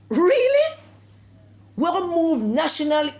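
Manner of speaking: shrieking high, loud "Really?"; incredulous. Real, not acted. Commentator scoffing at the national hero plan, incredulous shriek. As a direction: leap way up on "Really?" in outrage. Outraged disbelief at the heroes plan.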